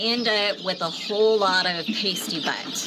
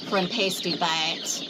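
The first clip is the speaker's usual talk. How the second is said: normal talking voice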